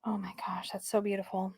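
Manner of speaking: very soft, low, fading into a whisper; hushed awe. Real, not acted. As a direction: murmur it in quiet admiration. Hushed admiration of card artwork.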